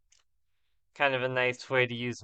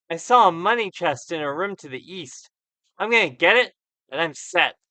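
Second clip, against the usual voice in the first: raised, scheming madman's boast; sly, slightly mad; 5 semitones higher, 8 dB louder than usual. Acted. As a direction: brag like a schemer with a secret. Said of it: "He gets a sly, slightly mad, conspiratorial look".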